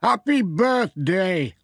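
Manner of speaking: a jolly farmer wishing Pat well; Alf Thompson. Acted. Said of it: "he said".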